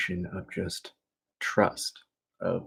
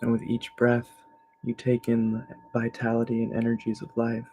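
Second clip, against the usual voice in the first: guided meditation; 3 semitones higher than usual. Real, not acted. Teacher shifting attention to navel and breath, calm meditation voice. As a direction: guide them calmly through each breath. Leads evening relaxation meditation.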